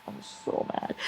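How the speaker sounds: whispered sulk; quiet whispered sulk. Real, not acted. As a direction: breathe it with no voice.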